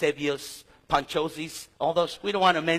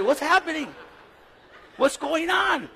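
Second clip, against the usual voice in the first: high, over-eager greeting; too friendly; 8 semitones higher, 4 dB louder than usual. Retold or imitated. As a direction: hail them like long-lost buddies. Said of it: "I'm a little too friendly".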